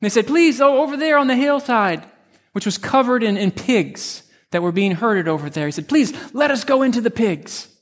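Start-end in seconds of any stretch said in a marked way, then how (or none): 0.3-2.0 pleading. Acted. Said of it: "plead with Jesus". 5.9-7.7 higher, begging spirits, lightly voiced; a touch of desperate begging; mock pleading. Spirits asking Jesus to send them into the pigs, higher mock plea. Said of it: "plead with Jesus".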